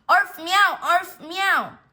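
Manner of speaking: high, loud alternating yelps; cat and dog squabble. Acted. Cat and dog fighting again, high yelping. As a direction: bat the animal noises back and forth.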